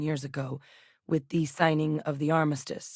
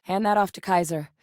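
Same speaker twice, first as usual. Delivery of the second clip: normal talking voice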